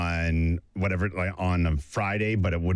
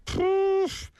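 sudden exclamation. Retold or imitated. Dad's reaction to eating early.

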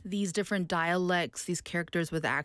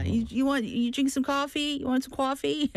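put-on higher, New York accent offering coffee; 7 semitones higher than usual. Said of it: "I love your New York accent".

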